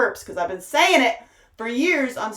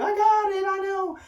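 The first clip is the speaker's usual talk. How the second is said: much higher, lightly mocking reassurance; high put-on voice; 11 semitones higher than usual. Speaker mimicking soothing listener, high pitched. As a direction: squeak it like a sympathetic friend.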